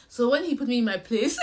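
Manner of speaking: ordinary talk, nothing marked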